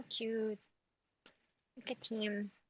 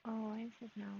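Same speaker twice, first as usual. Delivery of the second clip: normal talking voice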